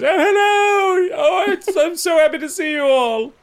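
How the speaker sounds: very high, loud, slow squeal of delight; overjoyed octopus voice. Acted. Orapan squealing hello to the returning crew, very high and loud. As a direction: shriek hello like an excited little creature.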